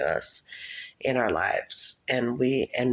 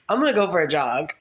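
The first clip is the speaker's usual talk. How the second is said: higher-pitched announcement through giggles; laughing; 4 semitones higher, 4 dB louder than usual. Retold or imitated. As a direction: laugh while acting out the exit. Acting her exit, laughing.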